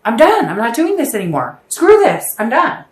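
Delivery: high, loud exasperated yelling; a shouted, fed-up "I'm done"; fed-up outburst. Retold or imitated. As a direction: blow up, totally done with them. Fed up after endless cheating.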